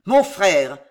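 loud growl of recognition; menacing exclamation. A narrator's reading. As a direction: growl it out forcefully at normal pitch. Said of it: "gronda François de Montmorency".

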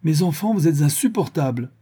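high, loud reproof. A narrator's reading. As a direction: raise your voice to scold them. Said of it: "La voix de madame de Bracieux s'éleva".

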